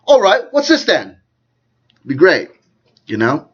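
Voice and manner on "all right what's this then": high, loud mock-British impersonation; David Icke. Fan playing around the house, loud high-pitched impersonation. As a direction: put on a hammed-up British voice, pitched well up. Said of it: "do a good David Icke impersonation".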